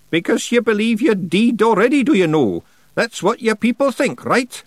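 high, loud character voice; pointed, high-pitched witch cross-examining; interrogating old witch. A narrator's reading. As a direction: press the rogue for an admission. Miss Treason questioning Rob.

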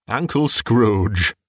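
slow, drawn-out party toast; a guest's slow, solemn toast to an absent uncle. Acted. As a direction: raise a glass and toast him slowly. Nephew repeats toast.

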